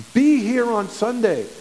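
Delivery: each word pressed out slowly; slow insistent urging. Real, not acted. Pastor urging his church to attend, slow and firm. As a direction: plead with the flock to come.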